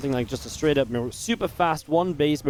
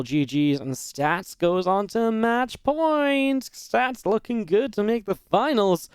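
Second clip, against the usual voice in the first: higher, drawn-out announcer call; match-point announcer call; 7 semitones higher, much slower than usual. Real, not acted. Caster announcing Stats going on to match point, drawn-out announcer call. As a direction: stretch it out on a raised, ringing pitch.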